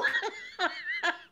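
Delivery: laugh. Real, not acted. Laughing at her own airport story.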